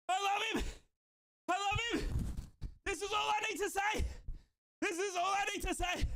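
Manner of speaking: high, overjoyed declaration; elated shouting. Real, not acted. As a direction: shout your love for the player up high. Declaring love for the scorer.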